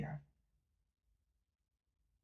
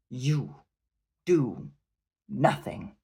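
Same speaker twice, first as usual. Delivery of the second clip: louder, each word hammered apart; shouted climax; 2 semitones higher, 7 dB louder, much slower than usual. A narrator's reading. Chieftain bellows his verdict at the cowering prince, shouting. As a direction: slam home the final insult.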